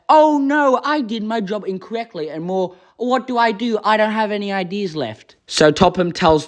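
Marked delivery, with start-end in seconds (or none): 0.1-2.3 high-pitched "oh no" fretting, easing down; mock dismay. Voicing Thomas fretting over a mistake, mock dismay. Voices Thomas's possible panicked reaction. 2.9-5.3 high, fretful put-on voice; mock helplessness. Voicing Thomas out of ideas, mock helplessness.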